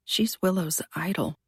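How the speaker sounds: plain reading voice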